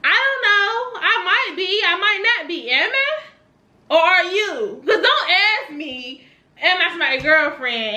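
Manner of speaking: high-pitched, taunting singsong questions; sassy comeback. Pretend. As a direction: throw the question back at them, high and teasing. Acting out defiant would-be comeback.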